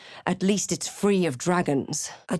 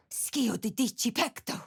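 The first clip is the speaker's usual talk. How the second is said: softer, urgent whispered incantation; whispered spell; 5 semitones higher, 6 dB quieter than usual. Acted. Cleric casting in secret, urgent whisper. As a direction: breathe the spell out fast.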